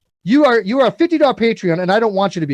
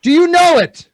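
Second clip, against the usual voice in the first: high, loud demanding yell; exasperated shout; 9 semitones higher, 6 dB louder, slower than usual. Real, not acted. Debater shouting at Chris to answer the question. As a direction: bark the question right at him.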